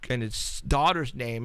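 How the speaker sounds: usual speaking voice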